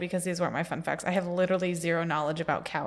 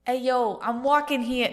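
loud mock New Yorker, pitched up; hammed-up New York accent; 4 semitones higher, 4 dB louder, slower than usual. Co-host aims at Jersey Shore, lands on New York accent. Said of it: "that's New York again".

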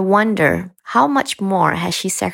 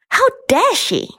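indignant. Acted. Outraged inner thought.

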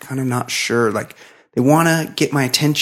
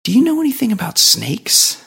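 a much higher, tentative lady voice; high nervous woman; 9 semitones higher than usual. Retold or imitated. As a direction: mimic a hesitant neighbor asking a favor. Imitating the nervous neighbor woman.

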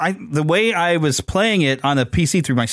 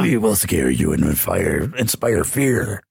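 deep, slow menacing villain growl; drawn out sinister threat, low and slow; evil voice; 7 semitones lower, much slower than usual. Pretend. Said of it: "So that's my evil voice".

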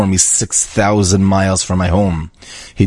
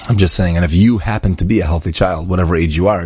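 usual speaking voice

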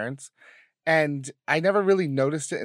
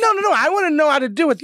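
high, loud imitation of insistent mom; mock insistent protest; 11 semitones higher, 7 dB louder than usual. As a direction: cut in high and loud as a stubborn parent. Acting out mom's insistent protest.

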